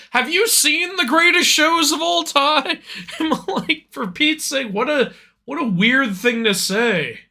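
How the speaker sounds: high-pitched, exasperated disbelief; baffled protest, pitched well up; incredulous. Retold or imitated. Answering a commenter's odd claim, incredulous.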